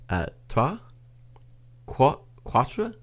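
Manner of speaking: "trois" and "quatre" as halting questions; lost French attempt. Shown as a demonstration. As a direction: stumble into "quatre", restarting after "Qua-". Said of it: "I'm lost now".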